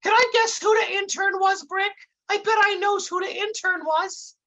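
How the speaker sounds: high, dim-witted sidekick explaining things; dopey Dummerton voice. Acted. Dummerton lecturing his partner Brick onstage, high dopey voice.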